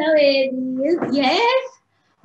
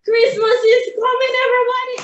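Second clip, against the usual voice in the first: excited call; 10 semitones higher, 4 dB louder, faster than usual. Real, not acted. Announces Christmas to children.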